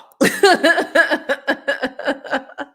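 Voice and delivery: big burst of laughter; hearty real laugh; loud laugh. Real, not acted. Laughs at own joke.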